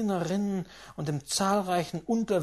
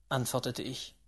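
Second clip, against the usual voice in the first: ordinary delivery, nothing marked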